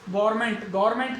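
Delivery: गवर्नमेंट squashed into two syllables, "GOR-ment"; mistaken "gorment". Shown as a demonstration. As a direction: say गवर्नमेंट as "gorment", losing the "van". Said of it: "गवर्नमेंट सही नहीं है वो".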